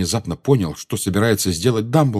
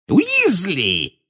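high, drawn-out summons; shouting; 11 semitones higher, 3 dB louder than usual. A narrator's reading. Minister summons his assistant, shouting. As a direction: call the name high and excitedly. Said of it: "закричал Фадж".

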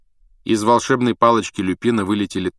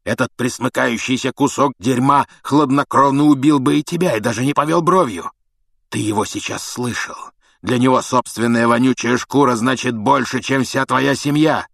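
raised, forceful tirade; angry tirade; 4 semitones higher, 4 dB louder than usual. A narrator's reading. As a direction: press the accusation with mounting indignation. (гневная обличительная речь)

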